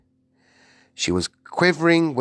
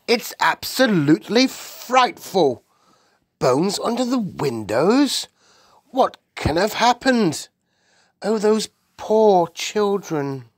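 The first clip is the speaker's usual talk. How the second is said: high, emphatic royal dismay; horrified outcry; 11 semitones higher, 4 dB louder than usual. A narrator's reading. The Queen reacts to the newspaper report, horrified. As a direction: exclaim aghast, dwelling on the horror. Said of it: "the famous voice cried out".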